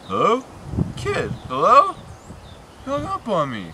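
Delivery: normal talking voice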